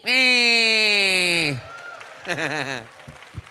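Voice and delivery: loud high cackle; cartoon villain laugh. Character laugh.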